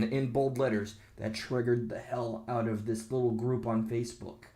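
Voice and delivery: normal talking voice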